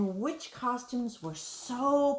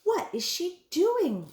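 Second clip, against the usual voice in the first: puzzled wondering; 10 semitones higher than usual. A narrator's reading. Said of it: "wondered Helen".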